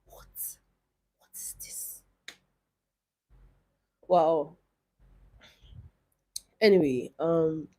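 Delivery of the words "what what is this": breathless whisper to herself. Real, not acted. Vlogger whispering to herself in shocked disbelief. Upset, barely speaking.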